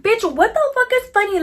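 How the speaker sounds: furious mock outrage at the laughing faces; indignant outburst. Indignant inner reaction to laughing faces.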